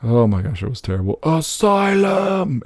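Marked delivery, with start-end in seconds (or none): 1.2-2.6 high, slow drawn-out cry; mock yell. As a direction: stretch out the game's call. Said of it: "it kept yelling in the background".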